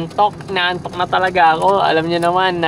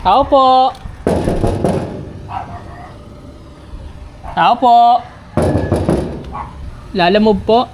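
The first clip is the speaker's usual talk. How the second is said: loud call to the house; 7 semitones higher, 3 dB louder, slower than usual. Real, not acted. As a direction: call out to whoever's inside. Delivery driver calling at gate.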